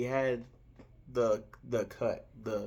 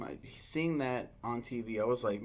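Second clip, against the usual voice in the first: ordinary talk, nothing marked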